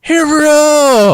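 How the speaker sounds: high, loud, long-drawn hoot. Acted. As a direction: belt it out high and stretch it hugely.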